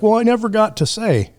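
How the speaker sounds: high-pitched mock regret; mock lament. Mimicking the living after a death, mock lament.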